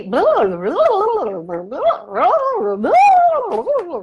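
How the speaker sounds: loud, high-pitched nonsense babble, jumping up and down; mock foreign gibberish. Local answering the lost traveler, in loud, high mock gibberish. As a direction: play a flustered local rattling off words you can't follow. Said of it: "or they're talking louder".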